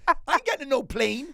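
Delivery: put-on high Scouse kid refusing, laughing; mock Scouse kid. Host repeats kid's refusal in Scouse accent. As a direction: repeat the stroppy Liverpool kid line. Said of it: "It's your kids in a Scouse accent".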